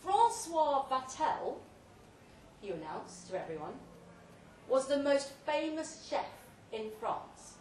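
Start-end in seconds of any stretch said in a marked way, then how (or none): 0.0-1.6 slow, booming toast to the crowd; proclaiming through a loud hailer; grand loud announcement. Acted. Speech to guests via loud hailer. 4.7-7.5 slow, booming toast to the crowd; proclaiming through a loud hailer; grand loud announcement. Acted. Speech to guests via loud hailer.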